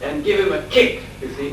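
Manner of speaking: normal talking voice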